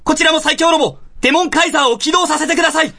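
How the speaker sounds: high, urgent alarm call; urgent crew alert. Acted. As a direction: sound the alarm as a crew member. S0 plays alarmed crew member.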